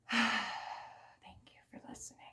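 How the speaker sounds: drained whispered thanks after a rant; sighing whisper. Real, not acted. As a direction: breathe it out, worn out. Weary whispered repeat of thanks.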